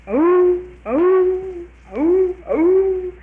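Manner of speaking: high, loud hound howls; bay like an old dog on the trail; howling hound coming fast. Retold or imitated. Dogs coming to the rescue.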